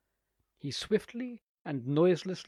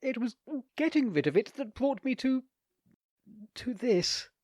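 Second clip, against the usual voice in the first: high, strained admission; gulp, then force it out; gulping confession; 9 semitones higher than usual. A narrator's reading. Theodoric to the lady, gulping, high and strained. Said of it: "with a gulp".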